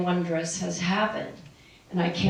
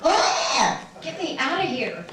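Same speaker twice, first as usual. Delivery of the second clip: a laughing, lightly voiced newborn complaining; newborn's mock wail, said through a laugh; mock crying baby; 6 semitones higher, slower than usual. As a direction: play a newborn begging to leave, half-laughing. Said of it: "Why do you think they all cry?".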